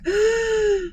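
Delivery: a high startled gasp; startled intake of breath; sharp gasp. Retold or imitated. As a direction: gasp in fright as you step back. Stepping back from roach.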